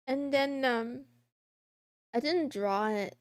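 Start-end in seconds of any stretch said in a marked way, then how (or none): none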